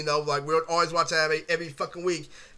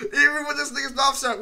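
laughing; 6 semitones higher, 4 dB louder than usual. Real, not acted. Still laughing from before.

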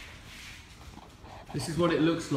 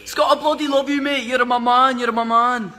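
loud, high-pitched Scottish shout; Scottish accent; 9 semitones higher, 7 dB louder than usual. Pretend. Singer hailing Scott who is watching, boisterous mock Scottish accent. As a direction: holler it in a hammed-up Scottish accent. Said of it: "Scottish accent".

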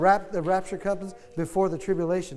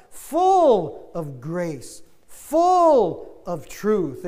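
slow, drawn-out, louder preaching; preached slowly; 5 dB louder, much slower than usual. Acted. Preacher proclaiming scripture to congregation, slow and drawn out. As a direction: stretch each phrase out.

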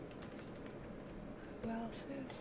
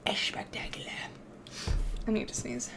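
whisper; 2 semitones higher, 11 dB quieter than usual. Real, not acted. Muttered aside.

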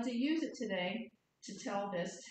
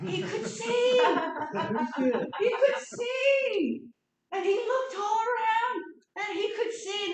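loud, high, laughing joy; telling the moment the blind man sees; joyful exclamation; 12 semitones higher, 8 dB louder than usual. A narrator's reading. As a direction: burst out with delight.

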